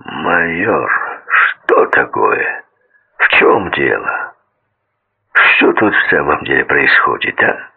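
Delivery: low, slow rumbling voice; rumbling agitated voice. A narrator's reading. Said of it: "пророкотал взбудораженный голос".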